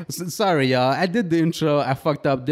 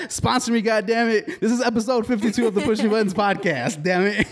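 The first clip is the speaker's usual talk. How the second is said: laughing podcast plug; 11 semitones higher than usual. Acted. Laughing rushed outro plug.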